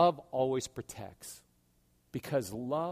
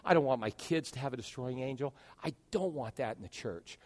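ordinary delivery

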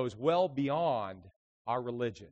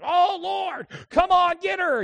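loud, high mock prayer shout; praying ladies' cry; 13 dB louder, slower than usual. Church ladies urging on the pastor's prayer, high hollering. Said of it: "all these ladies".

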